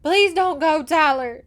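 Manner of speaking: high, loud, desperate pleading; crying plea. A narrator's reading. Young woman begs her love not to ride off, crying out. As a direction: plead at full pitch and volume. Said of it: "she cried".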